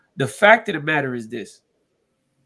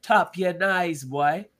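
put-on Jamaican accent.

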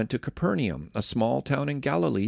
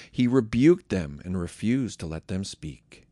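ordinary talk, nothing marked

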